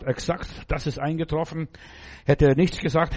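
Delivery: ordinary talk, nothing marked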